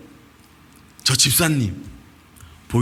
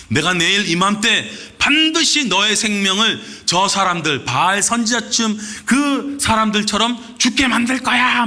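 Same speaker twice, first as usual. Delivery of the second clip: high, loud menacing threat; threatening; 7 semitones higher, 3 dB louder than usual. Retold or imitated. Preacher voicing Jezebel threatening Elijah, loud high menace. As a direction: hurl the threat at him like an enraged queen. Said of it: "하면서 협박을 해요".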